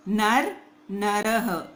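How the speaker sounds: नर plain, then नरः very slowly with a final ह; slow contrast pair. Shown as a demonstration. Teacher contrasting words without and with visarga for students. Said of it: "ह पढ़ना है".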